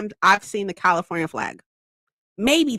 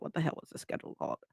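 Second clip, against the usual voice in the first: fast, soft whisper to herself; whispered aside; 7 semitones lower, 17 dB quieter, much faster than usual. Real, not acted. Trying to recall the app name, in a fast whisper. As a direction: whisper it quickly, half to yourself.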